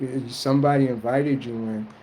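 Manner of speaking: usual speaking voice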